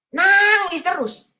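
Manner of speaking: drawn-out, high, loud exclamation; mock exasperation. Preacher retelling a woman's endless crying, mock exasperation. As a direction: stretch it out in exasperated disbelief.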